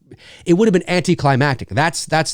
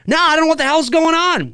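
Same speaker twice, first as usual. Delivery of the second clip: exasperated sheriff; 14 semitones higher than usual. Acted. Sheriff skit voice.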